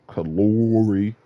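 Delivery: slow mock Virginia Kalori; imitating the accent. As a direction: savour the funky Kalori pronunciation. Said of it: "the little funky little accent".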